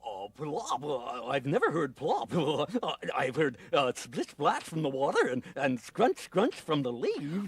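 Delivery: a slow, dopey beaver grumbling; slow dim beaver. Acted.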